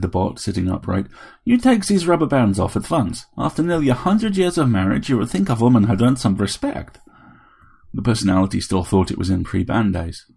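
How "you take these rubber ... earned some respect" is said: Helen, telling off. A narrator's reading. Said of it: "No one could tell him off like Helen".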